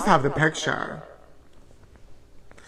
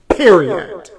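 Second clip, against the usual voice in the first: emphatic; 5 dB louder than usual. Acted. Emphatic sign-off word.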